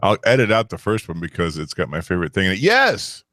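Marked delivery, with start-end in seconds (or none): 2.6-3.2 excited cheer. Real, not acted. Quiz host cheering a correct answer.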